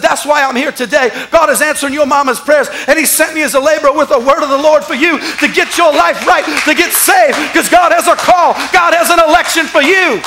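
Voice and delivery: high, building preacher's proclamation; fervent, rising sermon delivery; preaching. Acted. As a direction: build to a loud pulpit climax. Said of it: "preaching like I'm preaching a message".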